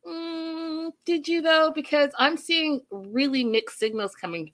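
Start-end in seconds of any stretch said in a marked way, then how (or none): 0.1-1.7 high sarcastic doubt; high, sarcastic skeptical retort. Retold or imitated. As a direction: raise the pitch in disbelief. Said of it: "And I was like".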